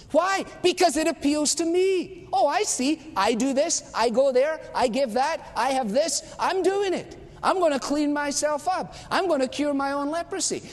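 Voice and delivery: high, smug self-improver; mock proud do-it-yourself believer; self-satisfied voice. Voicing a self-reliant believer.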